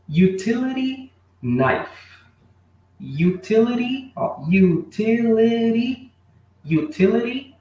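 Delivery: utility drawn out high and slow; slow pronunciation model. Shown as a demonstration. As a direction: lift and stretch utility for the class. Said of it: "Pronúncia, mais uma vez".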